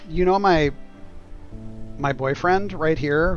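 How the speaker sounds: ordinary talk, nothing marked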